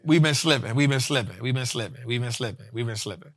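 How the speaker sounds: dwindling chant; fading refrain. Acted. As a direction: let it fade away like an echo.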